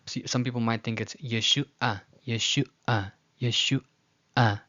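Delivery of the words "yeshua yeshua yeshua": Yeshua broken as ye-shu, pause, ah; wrongly read pause. Shown as a demonstration. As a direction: stop before the last ah of Yeshua. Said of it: "thinking that the ayin is, is a pause".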